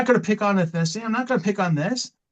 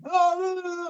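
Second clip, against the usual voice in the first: mock whiny kid; 10 semitones higher than usual. Mocking upset kids backstage.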